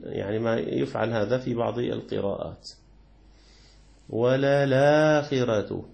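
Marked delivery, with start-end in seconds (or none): 4.1-5.9 slow ولا الآخرة with the hamza dropped, the ل taking its vowel; Warsh reading. Shown as a demonstration. Teacher reciting the Warsh reading of ولا الآخرة as an example. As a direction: read ولا الآخرة the way Warsh does, drawn out. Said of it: "هكذا يقرأ ورش".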